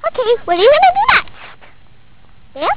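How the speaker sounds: loud. Real, not acted. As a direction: announce it loudly to camera. Vlogger narrating loudly to camera.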